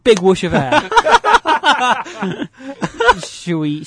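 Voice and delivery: ordinary talk, nothing marked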